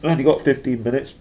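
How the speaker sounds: throat still catching; after a coughing fit. Real, not acted. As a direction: talk through a tickly throat.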